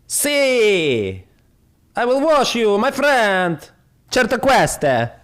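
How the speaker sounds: put-on Italian accent, cheerful and high; mock Italian. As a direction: gush like a grateful Italian fan. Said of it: "this guy from the Italy".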